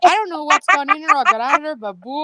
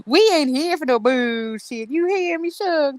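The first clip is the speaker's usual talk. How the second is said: Southern accent; 3 semitones higher than usual. Shown as a demonstration. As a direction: scold sweetly like a Southern belle. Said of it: "judging my Southern".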